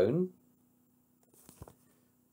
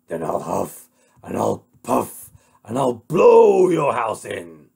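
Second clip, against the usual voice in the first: threatening wolf; 9 dB louder than usual. Acted. Wolf threatens to blow the house in, loud and menacing.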